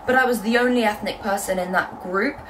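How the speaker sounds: ordinary talk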